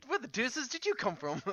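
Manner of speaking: a lightly put-on, high mock British accent. Player recalling her earlier British voice, high mock British accent. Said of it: "I was British earlier".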